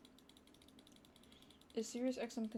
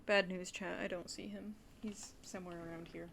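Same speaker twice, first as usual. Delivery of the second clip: ordinary talk, nothing marked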